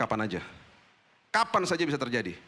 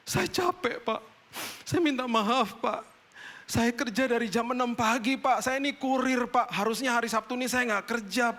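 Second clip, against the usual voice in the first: high, pleading voice of a weeping courier; tearful tired courier; 8 semitones higher than usual. Retold or imitated. Crashed courier apologizing to the driver, high tearful pleading. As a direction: beg forgiveness like an exhausted man near tears. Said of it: "Tiba-tiba dia nangis".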